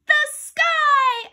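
very high, loud blurt; excited shout. A narrator's reading. Boy racing to answer a quiz, excited shout. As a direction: yell it before anyone else can. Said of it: "I shouted".

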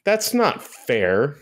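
high, drawn-out mock protest; mock complaint. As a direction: stretch it out like a jealous kid's complaint. Mock complaint that Beijing has it.

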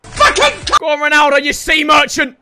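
loud, high celebration; excited shout. Real, not acted. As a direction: roar the goal. Celebrating the goal.

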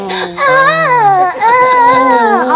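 high, hammed-up little moans.

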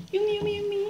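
very high excited "yummy" squeal; high-pitched yummy. Real, not acted. Vlogger relishing the food, high playful chirp.

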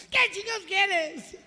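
high, loud, slow demand; hammed-up irritated yell; exasperated husband. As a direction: squeal it at her, fed up. Husband yells at his wife.

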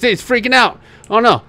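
high-pitched sudden alarm. Real, not acted. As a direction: voice leaps up in dismay. Game companion panics at fire.

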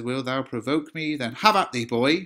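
loud, rising dare to fight; defiant challenge. Acted. Romeo daring Paris to fight, loud and defiant. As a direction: throw the challenge at the boy.

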